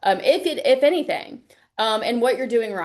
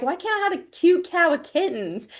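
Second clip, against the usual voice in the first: put-on a high, whiny complaint like a let-down kid; mock whine; 7 semitones higher than usual. To the volunteer, jokingly whining about getting the other cat.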